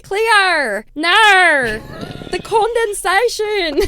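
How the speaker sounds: loud, high hammed-up Australian accent; over-the-top Australian accent; Australian meme accent. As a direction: shout it like the Australian mermaid meme. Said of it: "in British accents".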